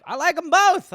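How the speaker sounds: high, emphatic. Retold or imitated. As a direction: insist brightly. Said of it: "I was like".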